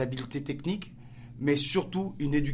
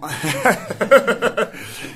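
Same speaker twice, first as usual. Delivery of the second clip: big real laugh, higher and louder; loud burst of laughter; 6 semitones higher, 12 dB louder than usual. Real, not acted. Guest laughing that getting to the islands is hard, loud laugh. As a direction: laugh out loud at your own point. Laughs at his own remark.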